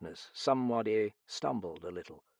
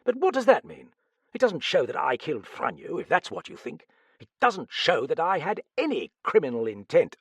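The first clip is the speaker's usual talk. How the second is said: raised, heated, emphatic protest; indignant self-defence; 6 semitones higher, 6 dB louder than usual. A narrator's reading. Accused man answering the detective, indignant. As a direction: climb higher and louder as you deny it. Arbour defends himself hotly.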